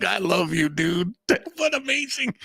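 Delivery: high, laughing voice full of affection; amused, high-pitched adoration; laughing delight. Retold or imitated. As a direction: gush through laughter, pitch riding up. Laughing, fond reaction to doctor's bluntness.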